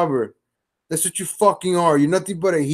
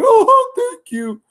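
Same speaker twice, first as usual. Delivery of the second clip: flattered squeal on the oh, then higher pleased gushing; mocking Jovi; 4 semitones higher than usual. Streamer mimics Jovi taking a compliment, flattered and higher. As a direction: play the gullible guy lapping up praise. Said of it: "He'll be like".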